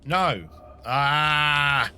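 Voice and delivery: sudden loud cry of dismay; frustrated shout. Real, not acted. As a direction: yell out in frustration. A puzzle move goes wrong.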